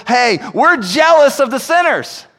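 mock envious churchgoer, loud and high; jealous churchgoer. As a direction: voice a believer who secretly envies sinners. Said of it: "We still struggle sometimes with".

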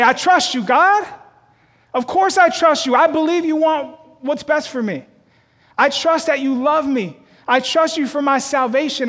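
high, loud eager believer; earnest believer. Retold or imitated. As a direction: gush your trust at God. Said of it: "where we would say".

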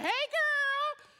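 high, bright sing-song hello; sky-high gushing hey girl; cheerful greeting. Retold or imitated. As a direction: greet your friend like she made your day. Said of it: "So I was like".

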